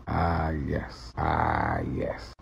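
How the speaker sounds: deep, quiet repeated "Ah, yes"; Morgan Freeman voice. Shown as a demonstration. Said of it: "build up strength with that voice".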